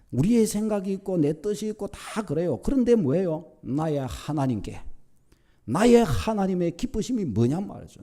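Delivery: high-pitched sermon exhortation, falling at sentence ends; forceful preaching. Real, not acted. Preacher urges congregation to put God first, forceful preaching. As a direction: press the congregation with insistent emphasis.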